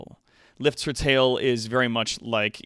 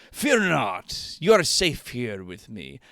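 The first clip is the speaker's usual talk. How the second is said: lusty lord voice; 3 semitones higher, 4 dB louder than usual. Acted. Streamer reading the lord's lines, slow and suggestive. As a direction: purr it like a sleazy nobleman. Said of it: "I'm playing both the characters".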